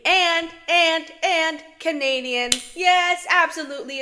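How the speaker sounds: loud, high, bursting enthusiasm; emphatic excitement. Real, not acted. Fan boasting the favourite movie is Canadian, excited. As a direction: pile on the praise loudly. Adds delighted praise of film.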